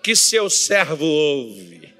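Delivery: loud prayer cry, pitch descending to the end; fervent shouted call. Shown as a demonstration. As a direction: lead the crowd in a full-force plea. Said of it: "Outra vez".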